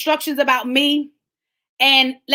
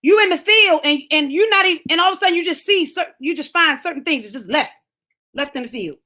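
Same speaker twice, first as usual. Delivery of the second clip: ordinary talk, nothing marked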